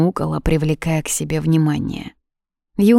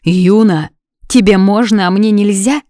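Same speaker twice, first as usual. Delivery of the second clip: louder, heated challenge; indignant protest; 3 semitones higher, 7 dB louder than usual. A narrator's reading. Lovers quarrel over leaving, indignant protest. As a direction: throw the reproach back at the other.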